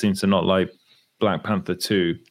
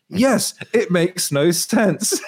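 high, slow, laughing put-on voice; laughing Harbour impression; 9 semitones higher, 3 dB louder, much slower than usual. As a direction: laugh through a mock actor's reply. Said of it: "And he's like".

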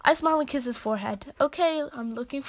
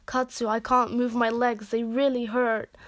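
plain reading voice

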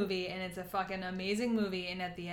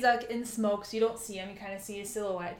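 ordinary talk

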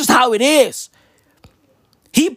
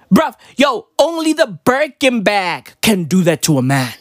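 usual speaking voice

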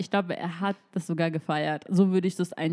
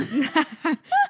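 bursting into a high laugh; sudden delighted laughter; real high laugh; 8 semitones higher, 4 dB louder than usual. Real, not acted. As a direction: laugh out loud at the joke. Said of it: "ich muss so lachen".

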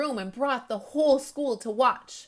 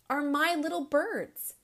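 gently, higher and tender; fond; 6 semitones higher than usual. Acted. Principal telling the boy all children are his birds, fondly. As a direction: tell them they're all yours.

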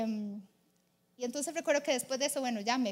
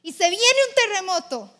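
very loud, high-pitched announcement; big forceful storytelling swell; dramatic proclamation; 5 semitones higher, 10 dB louder than usual. A narrator's reading. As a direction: thunder out the disaster. Dramatic telling of the earthquake.

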